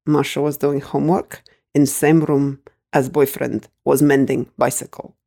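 put-on lightly Russian-accented, matter-of-fact old woman; granny's Russian accent. Granny explaining her evidence to mum, lightly Russian-accented. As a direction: state the absurd proof in a light Russian accent.

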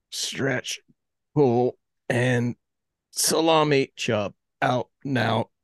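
usual speaking voice

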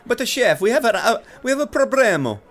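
high, loud worried Italian waiter; Italian accent. Acted. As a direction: panic in thick Italian. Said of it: "both boys speak in thick Italian accents".

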